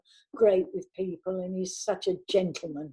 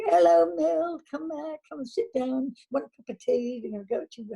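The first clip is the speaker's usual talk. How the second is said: imitating the makeup crew's bright welcome, pitched higher; cheery makeup artists; 6 semitones higher than usual. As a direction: sing out a friendly hello to an arriving actor. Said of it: "have them go".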